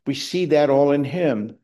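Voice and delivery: usual speaking voice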